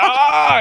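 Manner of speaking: high loud pained ah; pained cry. Retold or imitated. Retelling his own arm breaking, a high pained cry. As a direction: cry out in sudden pain. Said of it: "I'm like".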